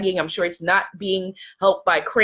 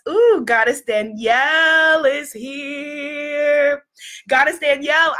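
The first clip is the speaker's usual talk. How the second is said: long drawn-out, higher-pitched announcement; excited welcome; 3 semitones higher, slower than usual. Real, not acted. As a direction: stretch the name out in delight. Excitedly greeting a viewer who arrives.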